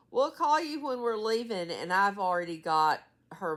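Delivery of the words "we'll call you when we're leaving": quoting her son, pitched up; lively mock of the son; imitating her son. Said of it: "my son always says".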